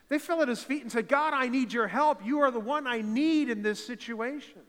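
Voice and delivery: loud, high, pleading preacher voice; impassioned preaching. Real, not acted. Pastor voicing the desperate plea to the church, loud and high. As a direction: cry it out like the desperate ones.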